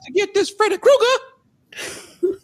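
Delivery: very high, loud put-on seventies jive voice; seventies movie jive talk. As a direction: ham up an old movie hero's jive talk. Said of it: "why are you talking like this".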